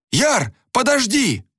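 slow, high-pitched urgent call; pleading high cry to wait; calling after someone. A narrator's reading. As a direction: stretch the call, pitch far up. Calling after departing friend.

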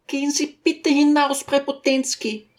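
high-pitched lady calling impatiently; impatient Besjukina. A narrator's reading. Lady orders the teacher out, impatient. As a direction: send him out, voice pitched high. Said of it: "rief die Besjukina ungeduldig".

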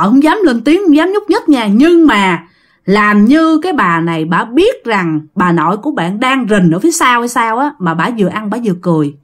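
ordinary delivery, nothing marked

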